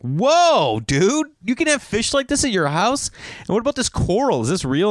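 high, awestruck whoa and questions; high-pitched amazement; amazed customer. Retold or imitated. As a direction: gawk at pricey fish like a wide-eyed kid. Amazed customer in fish shop.

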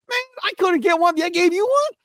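high mock whine from a teammate; high, disbelieving teammate voice; Chris Middleton. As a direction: squeak it like a jealous player. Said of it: "Chris Middleton be like".

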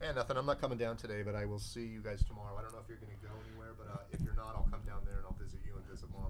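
talking on the phone; phone call. Real, not acted. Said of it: "put him on speaker".

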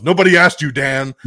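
loud put-down in a hammed-up Venom voice; mock Venom snap. Venom telling Dan nobody asked him, loud mock snap. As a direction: shut the boyfriend down, loud.

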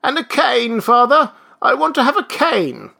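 put-on high, loud old man's demand; calling after. A narrator's reading. Old man calls after father, demanding shout. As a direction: call it insistently down the hall. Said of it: "voice followed him down into the hall".

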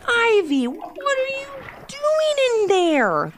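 high, drawn-out call to a sister; exasperated kid's high-pitched question; impatient calling. Acted. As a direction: call through a door, stretching the last words. Brother calls through bathroom door.

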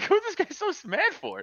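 high-pitched, giggly incredulity; squeaky-high amused bafflement; laughing disbelief. Retold or imitated. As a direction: retell it through helpless laughter. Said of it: "I just start laughing uncontrollably".

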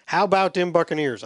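put-on a half-hearted stab at Jimmy Johnson's accent; mock Jimmy Johnson accent. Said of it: "in my best Jimmy Johnson accent".

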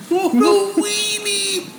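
high, drawn-out laughing repeat. Real, not acted. Repeating the insult about Olzek, laughing. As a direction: crack up while repeating the insult.

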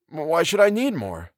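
simply put, high, slow question; mild, artless old professor; simple. A narrator's reading. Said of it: "the professor added simply".

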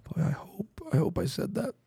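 anxious whispered self-talk; nervous murmur to yourself; worried whisper. Retold or imitated. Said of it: "and be going".

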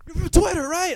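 high, loud excited recognition; imitating interviewer. Interviewer recognises him, high loud voice. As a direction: mimic an eager interviewer. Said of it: "they're like".

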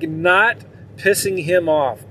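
ordinary talk

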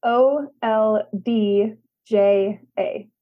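one letter at a time, spaced out; slow spelling. Shown as a demonstration. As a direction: spell it out slowly for listeners to write down. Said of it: "Spell it again slowly".

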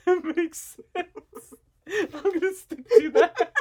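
talking through high, wheezy laughter; helpless laughter. Real, not acted. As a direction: barely get the words out for laughing. Cracking up at own joke.

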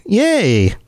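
high, bright mock cheer; cheerful yay. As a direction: cheer it like a kid. Mock cheer for patron bonus.

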